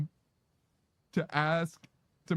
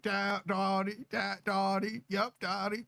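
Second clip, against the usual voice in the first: put-on Southern accent; 3 semitones higher than usual. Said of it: "That's Southern Daddy".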